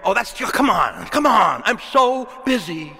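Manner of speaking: high, loud, exasperated brush-off. Retold or imitated. Person brushing off God's call to prayer, exasperated and loud. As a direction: come on, I'm so busy, tossed off high and loud. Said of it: "And then God says".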